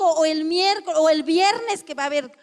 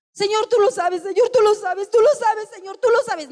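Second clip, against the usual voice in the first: mocking a rambling pleading prayer, higher and louder; vague repetitive prayer; 5 semitones higher, 5 dB louder than usual. Preacher mocks a believer's vague, repeated prayer, raised and loud. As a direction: parrot the same plea over and over, whining to God. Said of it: "No, no lo sabe el Señor".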